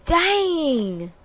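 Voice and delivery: high, loud drawn-out exclamation; shocked yell. Real, not acted. Player reacts to game scare, shocked yell.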